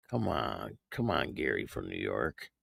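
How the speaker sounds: low, hushed mock taunt; quiet teasing. Host teasing chatter Gary from New York, hushed and mock-coaxing. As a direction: coax him softly with a teasing edge.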